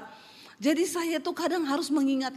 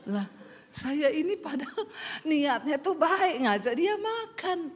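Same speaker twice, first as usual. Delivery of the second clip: usual speaking voice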